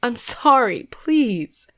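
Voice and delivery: high, desperate begging; hysterical pleading. A narrator's reading. Kneeling girl begging the statue, high and desperate. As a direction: cry out for mercy. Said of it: "horrified and hysterical".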